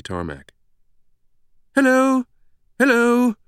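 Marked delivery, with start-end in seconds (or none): 1.7-3.4 high, loud, musical British hail; sing-song British shout. A narrator's reading. British woman hailing an arriving professor across the tarmac, sing-song shout. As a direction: call out a lilting British hello from far off. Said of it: "a sing-song British voice shouted from across the tarmac".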